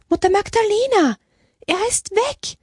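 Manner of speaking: high-pitched cry of alarming news; alarmed calling out. A narrator's reading. Sister back from the chapel to report him gone, alarmed calling out. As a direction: call out to the abbess in a high, urgent voice. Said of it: "rief Schwester Mary Ann".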